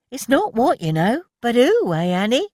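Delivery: high, loud teasing; a cheeky friend ribbing Annie; playful wink. A narrator's reading. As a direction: tease her with a wink. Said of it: "with a playful wink".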